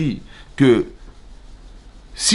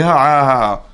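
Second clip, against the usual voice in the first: louder "ah-ah-ah" of delight; pleased sounds in place of words; happy wordless cry; 3 semitones higher, 3 dB louder, slower than usual. Acted. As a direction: greet someone with joyful noise only. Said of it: "il a fait".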